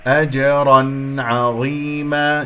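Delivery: أجرا عظيما slow, bounced jeem; measured recitation of أجرا عظيما; qalqala demo. Shown as a demonstration. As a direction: lightly echo the j of أجرا, hold عظيما long. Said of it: "جيم مقلقلة قلقلة صغرى".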